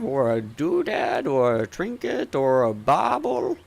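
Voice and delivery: swooping merchant's sales patter; doddery shopkeeper, eager. Acted. Shopkeeper offering magic items to customers, eager and rambling. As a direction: tempt the customer with your wares, hesitating between items.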